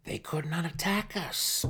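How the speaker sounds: high, insistent denial; stubborn. A narrator's reading. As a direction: refuse to accept it. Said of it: "Malice said stubbornly".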